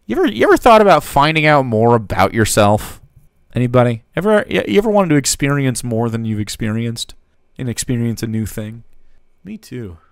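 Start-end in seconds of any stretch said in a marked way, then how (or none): 0.1-7.1 loud, pointed questioning; loud challenge. Real, not acted. Reacting to bad roleplay advice, loud and challenging. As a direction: challenge them loudly. Mock challenge to the video's speaker.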